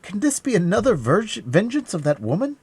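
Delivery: loud, rising suspicion; alarmed cry. A narrator's reading. D'Artagnan suspecting a woman's vengeance, rising alarmed cry. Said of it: "cried D'Artagnan".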